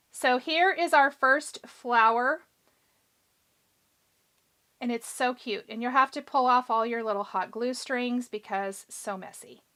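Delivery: usual speaking voice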